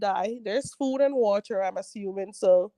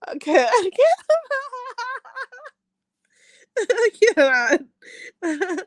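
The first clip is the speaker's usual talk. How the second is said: laughing; 12 semitones higher, 6 dB louder, faster than usual. Real, not acted. Cracking up at comment.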